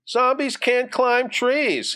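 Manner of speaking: high, slow, dismissive sister; know-it-all sister. Acted. Sister dismisses Johnny's zombie claim, know-it-all. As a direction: pitch it way up and stretch it out.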